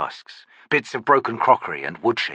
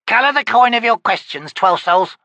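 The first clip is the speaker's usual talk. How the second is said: put-on old witch; 12 semitones higher, 6 dB louder than usual. Witch grumbling at her visitor, high crone voice. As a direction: croak it like a cranky hag.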